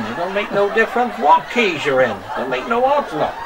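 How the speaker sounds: broad Somerset farmhand voice, kept up all through; Somerset accent. Acted. Farmer showing off his dog to the camera, in a broad Somerset accent. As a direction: play a rustic Somerset yokel who's chatting away. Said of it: "See if I got the accent again".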